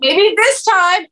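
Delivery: high, slow, drawn-out hope; mock hopeful viewer. Imitating a Friends viewer rooting for Ross, mock hopeful.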